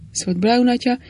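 plain reading voice

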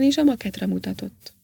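plain reading voice

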